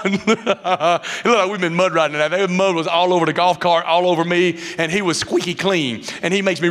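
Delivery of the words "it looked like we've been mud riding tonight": laughing. Real, not acted. Laughing while recalling mud.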